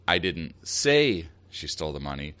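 "say" punched and stretched, short pause after; stress on SAY. Shown as a demonstration. Host giving an example of shifting meaning, stress on "say". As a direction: land hard on "say", break, then drop off. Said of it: "emphasizing one word in that sentence".

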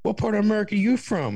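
high, loud American tourist. Retold or imitated. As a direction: ham up the curious Yank. American tourist quizzing him.

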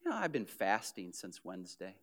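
low, soft, mock-humble mumble; humble-brag. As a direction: play down the fast while showing it off. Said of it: "you'll say".

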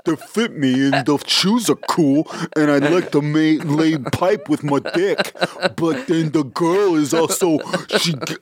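high, dopey mocking bro voice; asshole letter writer. Co-host mocking the letter writer who got shoes, babyish bro voice. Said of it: "That's what you sound like".

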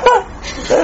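short high laugh bursting out; high squealing laugh. Real, not acted. A preacher laughing at the crowd's excuse.

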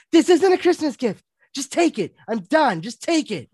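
loud, high-pitched exasperated insistence; fed-up outburst. Retold or imitated. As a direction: act out someone thrusting a gift over, done arguing. Wife's fed-up outburst, acted.

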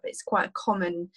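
usual speaking voice

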